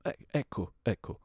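halting, frightened compliance; petrified. Acted. Host frozen under the aimed gun, petrified. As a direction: freeze and stammer agreement. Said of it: "restando come impietrito".

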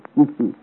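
put-on a short dry old man's chuckle. Old duke to his servant, a wistful chuckle. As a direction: chuckle to yourself at the old days.

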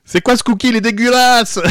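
high, loud disgusted yelp; disgusted cookie eater. Pretend.

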